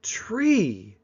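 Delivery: high, drawn-out naming of a tree; child naming a tree. Acted. One child points out a tree to another, childlike naming. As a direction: voice a child slowly pointing something out. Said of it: "one child ... points to a tree and goes".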